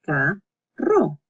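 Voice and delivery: "Carro" in two pieces, ca and rolled rro; pause, then trill the rr of "Carro"; double R, by syllables. Shown as a demonstration. Teacher repeating the double R, "Carro" split in two. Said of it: "it's another double R".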